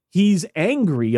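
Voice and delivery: ordinary talk, nothing marked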